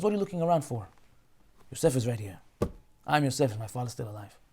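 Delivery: soft, low, hushed disclosure; quiet reveal. Acted. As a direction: drop the voice to reveal the secret. Yosef reveals himself quietly.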